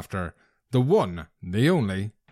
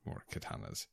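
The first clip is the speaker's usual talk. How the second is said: whispered; 18 dB quieter than usual. Real, not acted. Trailing, unvoiced aside.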